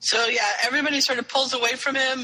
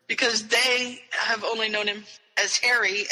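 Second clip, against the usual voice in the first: ordinary talk, nothing marked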